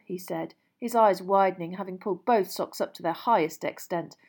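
ordinary delivery, nothing marked

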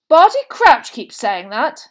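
loud, high, slow innocence; innocent. A narrator's reading. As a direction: say it slowly, all guileless, at volume. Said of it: "his round eyes widening innocently".

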